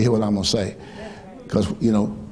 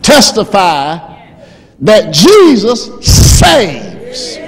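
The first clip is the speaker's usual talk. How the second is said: high, loud, slow preaching; preaching at full force; 13 semitones higher, 5 dB louder than usual. Acted. Preacher crying out to the church about saving power, loud and high. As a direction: whoop it to the church.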